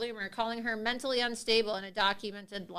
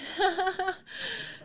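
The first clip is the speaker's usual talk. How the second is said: high burst of laughter; 9 semitones higher than usual. Real, not acted. Laughing at her own impression.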